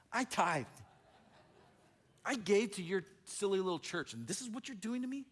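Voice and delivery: high, aggrieved mock complaint; retold how-dare-you outrage; indignant protest. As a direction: act out wounded disbelief at God, pitch climbing. Acting out past complaint to God.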